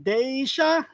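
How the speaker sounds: "Deisha" drawn out, sounding out the name; said slowly. Real, not acted. Streamer reading a viewer's name, "Deisha" said slowly. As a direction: take "Deisha" slowly, stretching it. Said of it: "I said it kinda slow".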